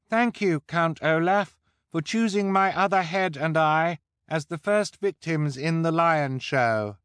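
high, loud, grandiose disguise voice; grand high-pitched voice. A narrator's reading. Klaus in disguise thanking Count Olaf before the crowd, grand high-pitched voice. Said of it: "said grandly in his high-pitched voice".

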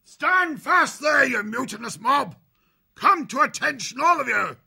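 high, loud parade-ground bellow; officers' roar. A narrator's reading. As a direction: roar the mob to attention. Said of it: "The roars of the three officers froze the crew".

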